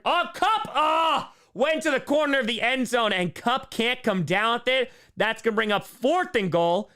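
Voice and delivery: loud, high cry settling into commentary; play-by-play. Real, not acted. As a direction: cry out at the near catch, then call it. Live play-by-play call.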